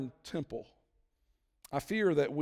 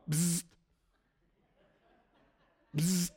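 buzzing sound. Retold or imitated. Imitating phone vibration.

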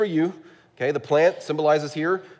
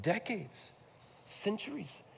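soft pleading God; 7 semitones higher, 9 dB quieter than usual. Retold or imitated. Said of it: "I imagine God saying".

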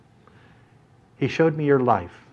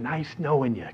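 soft muttered farewell; under the breath; 9 dB quieter than usual. Retold or imitated. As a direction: mutter it aside, much softer. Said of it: "under my breath I said".

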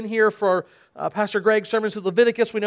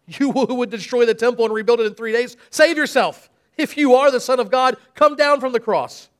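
higher, louder taunting; deriding; 3 semitones higher, 4 dB louder than usual. Acted. Passersby taunt Jesus on the cross, loud jeer. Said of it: "derided him".

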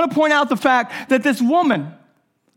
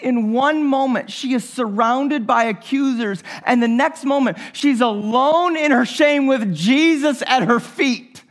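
normal talking voice

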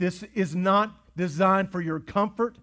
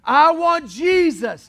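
higher slow shout; 11 semitones higher, 12 dB louder, much slower than usual. Acted. Repeated cry rising.

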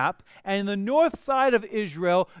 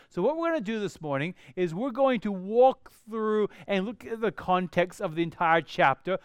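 ordinary delivery

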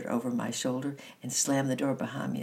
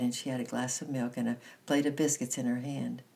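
ordinary delivery, nothing marked

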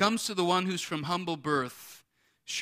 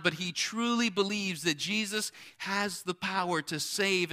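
higher, driving preacher delivery; emphatic preaching; 5 semitones higher, 4 dB louder than usual. Real, not acted. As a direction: press the point home, stretching the final words. Preaches with force.

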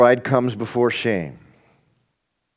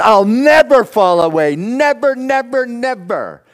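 higher and louder, hammering each never; Peter's loud vow; 11 semitones higher, 8 dB louder than usual. Retold or imitated. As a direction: declare it with bold bravado. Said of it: "right after Peter declared".

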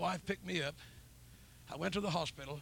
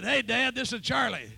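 loud eager hello, pitched up; eager greeting; 6 semitones higher, 13 dB louder than usual. Retold or imitated. Son phoning dad with loud eager greeting. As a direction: burst out proudly to dad. Calling dad excitedly on phone.

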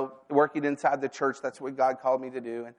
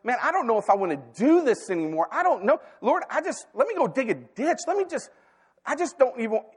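much higher, pleading and worn out; desperate plea; 8 semitones higher than usual. Retold or imitated. Preacher reliving his plea to God about quitting ministry, desperate and high. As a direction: cry out to God like you're ready to quit. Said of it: "so desperate and so frustrated".

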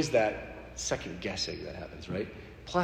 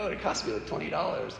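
ordinary talk, nothing marked

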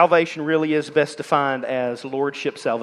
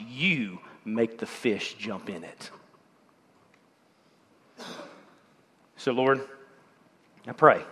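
closing prayer; 3 semitones lower than usual. Acted.

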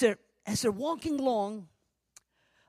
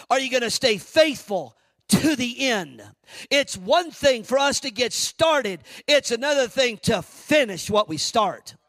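higher and louder preaching drive; fiery preaching; 7 semitones higher, 8 dB louder than usual. Acted. Preacher urging the congregation to finish what they start, fervent. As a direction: press the point home at full voice.

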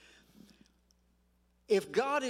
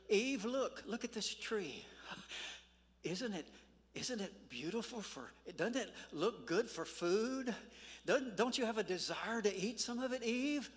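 lightly voiced tempter, softer, coaxing; 5 dB quieter than usual. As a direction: coax Eve quietly, with a hint of the tempter. Preacher voices enemy tempting Eve.

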